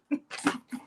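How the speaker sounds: brief soft, breathy chuckle; real laugh. Real, not acted. Host laughing at getting carried away, soft chuckle. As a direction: let a small laugh slip out. Laughs after her own impression.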